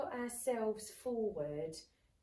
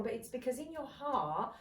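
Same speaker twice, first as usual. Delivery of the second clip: ordinary talk, nothing marked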